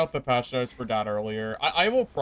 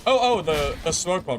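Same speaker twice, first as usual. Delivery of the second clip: sudden higher, louder cry; startled panic as it fails; alarmed; 6 semitones higher, 4 dB louder than usual. Real, not acted. Gamer panicking as the smoke bomb fails.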